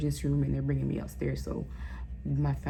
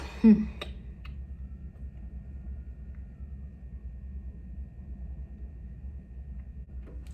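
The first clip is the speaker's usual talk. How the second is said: long unvoiced shaky gasping laugh through tears; choked breathy laugh-sob; 4 semitones higher, 7 dB quieter than usual. Real, not acted. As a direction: laugh to keep from crying. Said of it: "I'm sorry, y'all".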